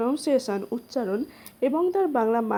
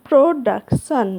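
Production said slowly and much louder; slow emphatic pronunciation; 9 dB louder, slower than usual. Shown as a demonstration. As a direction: announce production loudly and slowly. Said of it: "ইংরেজি উচ্চারণটা হচ্ছে". (জোরে ধীরে উচ্চারণ)

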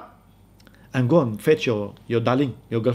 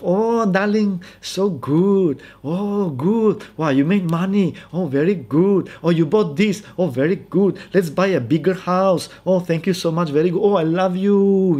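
higher, sing-song gushing wife; adoring wife; 4 semitones higher than usual. Acted. Wife praises husband who made money, mock gushing. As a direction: gush over him like a hammed-up doting wife. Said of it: "she is that wife".

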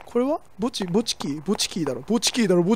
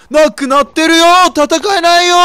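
loud, high cry of dismay; alarmed shout; 7 semitones higher, 11 dB louder than usual. Real, not acted. Player realizing his weapon is gone, loud and high. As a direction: yell out in panic. Machete vanished, panics.

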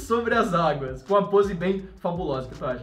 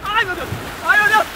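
startled cry; 10 semitones higher, 6 dB louder than usual. Real, not acted. Jumping into cold water.

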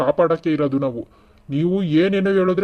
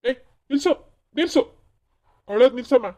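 higher, short sharp orders; stern command; 6 semitones higher, faster than usual. Acted. Officer tells sobbing woman to stop crying, stern. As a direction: cut in firmly to stop the crying.

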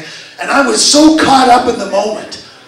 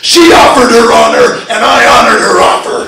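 boastful wrestler cutting a promo; wrestling voice. Shown as a demonstration. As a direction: punch out the rhymes like a ring showman. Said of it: "if I use my wrestling voice".

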